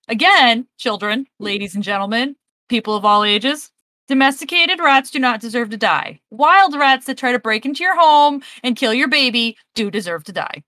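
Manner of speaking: ordinary talk, nothing marked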